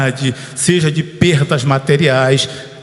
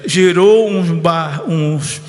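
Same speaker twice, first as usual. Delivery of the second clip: ordinary delivery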